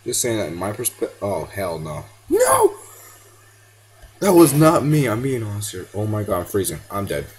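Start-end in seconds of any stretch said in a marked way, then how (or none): 2.3-2.8 sudden high, loud yelp of protest; real startled shout at the game. Real, not acted. 4.2-5.1 loud protest. Real, not acted. Protesting loudly in game.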